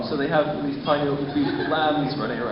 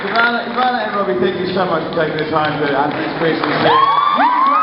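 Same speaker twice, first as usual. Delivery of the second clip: announcer; 4 semitones higher, 6 dB louder than usual. Acted. Host thanking guests over music.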